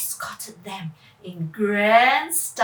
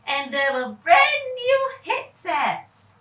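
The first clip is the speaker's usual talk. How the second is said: plain reading voice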